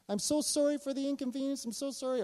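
high-pitched, repeated apologizing; imitating profuse apology. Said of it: "she kept apologizing to us".

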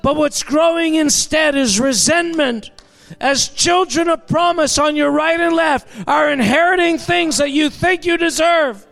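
full-force revival preacher; fiery preaching. Real, not acted. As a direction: preach it out to the whole room.

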